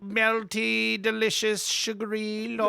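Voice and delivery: high, slow reverent swooning; slow, high, worshipful rapture; Michael Palin. Pretend. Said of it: "what are you doing, Michael Palin".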